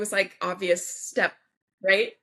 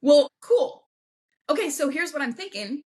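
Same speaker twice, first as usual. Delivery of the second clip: lightly acted, pitched-up matter-of-fact reply; practical boyfriend; 7 semitones higher, 5 dB louder than usual. Boyfriend answers next day with a plan, practical. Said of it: "in his practical way".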